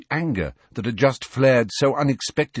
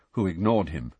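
plain reading voice

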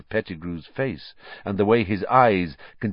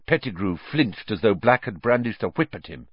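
ordinary delivery, nothing marked

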